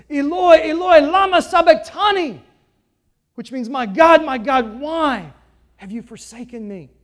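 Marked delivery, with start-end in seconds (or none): 0.1-2.4 high, loud cry of anguish. Acted. Jesus on the cross crying out to God, loud anguish. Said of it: "he cried out in a loud voice". 3.7-6.9 loud cry fading at the end; anguished cry. Acted. Jesus crying to God from the cross, anguished cry that fades. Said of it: "he cried out in a loud voice".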